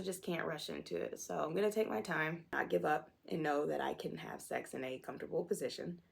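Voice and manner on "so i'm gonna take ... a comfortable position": ordinary talk, nothing marked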